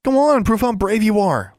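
high, loud taunting dare; jeering boy's challenge; scoffing. A narrator's reading. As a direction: goad them with open scorn. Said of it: "Larry scoffed".

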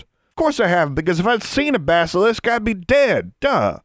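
put-on high-pitched, exasperated kid retort; bickering twin. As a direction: talk back to a sibling as if it's obvious. Voices Taylor bickering with twin.

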